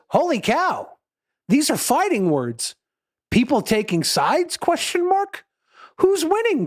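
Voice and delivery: mock-excited dad voice, pitched high; hammed-up amazement, swooping up high on the questions; Tom Hanks. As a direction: play an eagerly curious father, high-pitched. Acts out Tom Hanks's excited reply.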